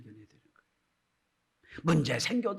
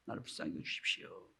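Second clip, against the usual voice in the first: whispered, much softer plea; cry for mercy; 8 semitones lower, 15 dB quieter than usual. Retold or imitated. Bartimaeus cries to Jesus again, whispered. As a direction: murmur it as an intimate cry. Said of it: "또 소리 지릅니다".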